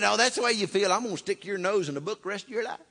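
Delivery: high, loud mock scolding; a playful scold, rushed; God's retort. God teasing the proud student, mock-stern. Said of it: "He said".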